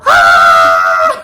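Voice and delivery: loud startled shriek; sudden loud scream; retold scream. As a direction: shriek in fright at the mouse poop. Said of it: "the kids heard me go".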